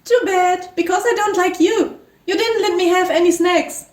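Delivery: high-pitched character voice; petty refusal in a high little voice; Paula. Acted. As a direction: pitch it up, pouting. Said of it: "Paula speaks".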